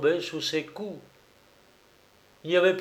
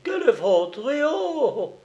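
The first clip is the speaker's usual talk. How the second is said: much higher, scandalised exclamation; indignant gasp; 12 semitones higher than usual. A narrator's reading. Colombine outraged by the lie.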